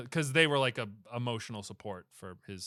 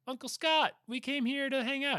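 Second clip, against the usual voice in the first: high-pitched little niece voice; excited niece; 12 semitones higher than usual. Acted. Niece greeting her uncle Santa, high child voice. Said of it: "She's like".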